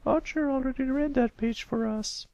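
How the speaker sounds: high patient teacher voice. A narrator's reading. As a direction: speak as a kindly schoolteacher to a pupil. Miss Shirley voiced.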